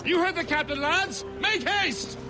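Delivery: very high shout to hurry the men; urgent shouted order. Acted. Mate relaying the captain's order to the lads, urgent shout. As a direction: holler to the crew to get moving. First mate hurries the crew.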